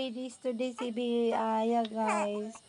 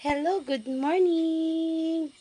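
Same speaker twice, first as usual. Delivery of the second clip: cooing greeting; 7 semitones higher, 4 dB louder, slower than usual. Real, not acted. Greeting the baby brightly.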